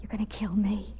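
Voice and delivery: frightened hushed whisper. Acted. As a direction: barely voice the fear. Facing the killer.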